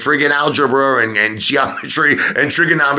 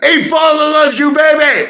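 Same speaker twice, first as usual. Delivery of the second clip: mock fatherly gush; 4 dB louder than usual. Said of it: "And I'll say".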